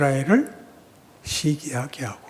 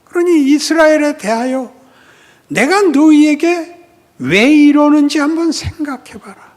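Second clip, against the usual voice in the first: loud, higher preaching rebuke; fiery preaching; 13 semitones higher, 13 dB louder than usual. Acted. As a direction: drive the point home with force. Preaching at full force.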